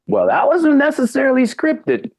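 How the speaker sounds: ordinary talk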